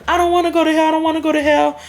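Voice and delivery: high-pitched frantic mock plea; imitating panic. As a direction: act out tweaking, voice pushed up high. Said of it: "I started tweaking".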